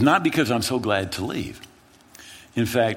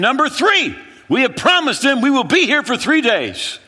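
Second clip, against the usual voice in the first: high, loud counting off; loud retort; 7 dB louder than usual. Retold or imitated. The friend answering the panicked pastor, a loud retort. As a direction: shout back the next reason.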